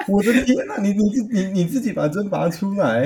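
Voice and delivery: shocked. Real, not acted. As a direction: gasp it out in disbelief. Shocked reaction to pulling stinger.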